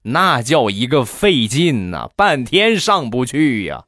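neighbour's slow, stretched-out grumbling; slow retold neighbour's complaint; drawn-out complaint. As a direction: draw each phrase out, labouring over it. Acted neighbour dragging out complaint.

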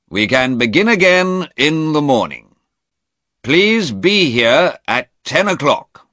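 high, loud, formal instruction; judge adjourning court. A narrator's reading. Judge adjourns court until morning.

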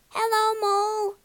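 high, sustained sing-out greeting; calling across. A narrator's reading. Animals greet across the river.

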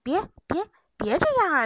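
loud, high panicky pleading; frightened cry. Acted. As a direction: beg in sudden fright. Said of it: "小松鼠叫了起来".

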